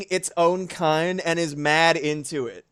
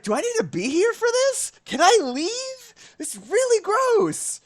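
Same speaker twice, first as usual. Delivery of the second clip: acted squeamish protest. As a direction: whine it way up high, dragging the end. Acted reaction to gross ad.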